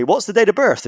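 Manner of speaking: lightly voiced service rep; mock call-centre agent.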